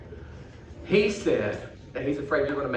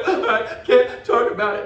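upset puppet voice; 7 semitones higher, 6 dB louder, slower than usual. Acted.

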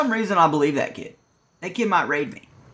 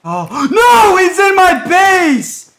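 panicked shout; 12 semitones higher, 8 dB louder, much slower than usual. Real, not acted. Raider in his base.

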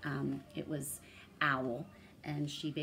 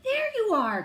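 high, loud relieved mother; mother's cry; 8 dB louder than usual. A narrator's reading. Mama Hyena cries out as Penduli runs up. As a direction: cry out on seeing your child. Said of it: "cried Mama Hyena".

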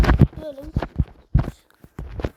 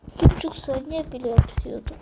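ordinary talk

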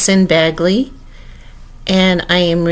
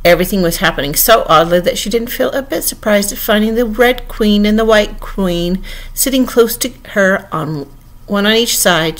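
plain reading voice

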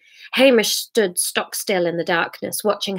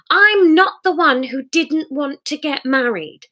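high, raised, wounded protest; hurt angry man; 7 semitones higher than usual. Acted. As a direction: throw it back at her.

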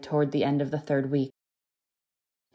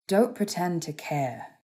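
slow, cold rebuff; slower than usual. A narrator's reading. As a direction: say it slowly, withholding all warmth. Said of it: "he said coldly".

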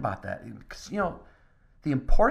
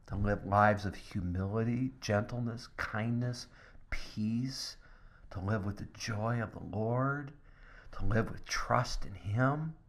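hushed, gentle, near a whisper; soft tender sermon voice; 2 semitones lower, 7 dB quieter than usual. Real, not acted. Pastor comforting the congregation, hushed and tender.

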